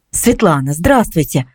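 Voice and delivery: ordinary delivery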